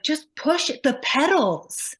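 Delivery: put-on impatient parent barking at a toddler; frustrated mother. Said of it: "I just was so mad at him".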